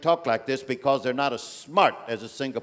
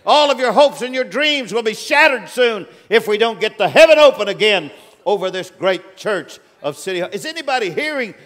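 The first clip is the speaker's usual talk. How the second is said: preaching at full force; 5 semitones higher, 5 dB louder than usual. Real, not acted. Man of God warning the church, loud and high. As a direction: thunder the warning over the house.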